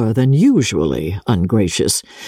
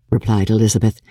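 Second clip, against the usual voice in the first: ordinary delivery, nothing marked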